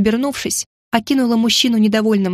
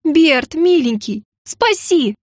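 high, wheedling cry for rescue; desperate plea; 5 semitones higher, much slower than usual. A narrator's reading. Heroine carried off begs the cook, pleading. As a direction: implore him urgently.

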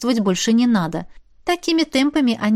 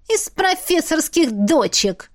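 slow, higher, mocking; sarcastic jab; 7 semitones higher, slower than usual. A narrator's reading. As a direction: drawl the insult sweetly. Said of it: "съязвила брюнетка". (язвительно, медленно)

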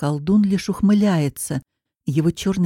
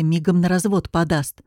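ordinary delivery

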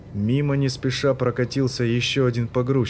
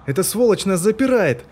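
high, loud furious cry; angry shout; 9 semitones higher, 5 dB louder than usual. A narrator's reading. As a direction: yell in outrage. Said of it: "закричал он".

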